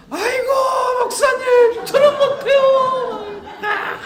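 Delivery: panicked wail. Retold or imitated. Church member begging the pastor off a duty, panicked wail. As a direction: yelp like begging to be spared. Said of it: "살려달라는 거예요".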